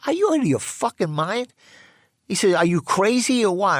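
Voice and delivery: high, loud retold yell of a friend just punched; retold furious yell. Pinned against the wall, Charlie yells in retold fury. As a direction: shout him down like you could kill him.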